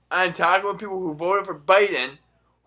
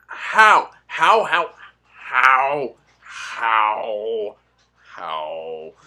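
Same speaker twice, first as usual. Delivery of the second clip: fading disbelief; 5 semitones lower, 4 dB louder, much slower than usual. Real, not acted. Stunned viewer asking how, fading out. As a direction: let the disbelief drain away.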